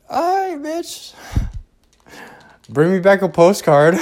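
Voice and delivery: mock sarcastic. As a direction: toss off a squeaky high sarcastic goodbye to a friend. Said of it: "I'm like".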